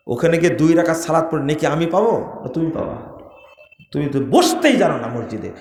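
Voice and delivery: normal talking voice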